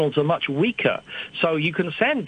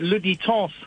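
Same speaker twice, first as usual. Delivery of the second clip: says "Le Détente" the French way, nasal ending; French accent; 4 semitones higher than usual. Real, not acted. Naming the satellite in an interview, in a French accent. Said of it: "why am I saying that in a French accent".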